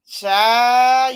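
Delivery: loud exclamation; loud drawn-out exclamation. Real, not acted. Exclamation before a topic.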